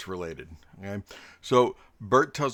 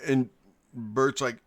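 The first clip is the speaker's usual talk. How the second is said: normal talking voice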